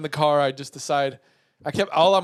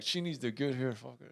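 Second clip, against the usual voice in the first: muttered curse; 3 semitones lower, 8 dB quieter than usual. Retold or imitated. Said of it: "I'm just cursing".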